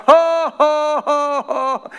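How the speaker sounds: high, loud forced ha-has; put-on defiant laugh. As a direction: laugh in the devil's face, obviously faked. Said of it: "He did that several times".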